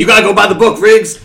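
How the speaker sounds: loud hammed-up sergeant yelling; mock cop-movie shout. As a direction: bark it like an eighties movie chief.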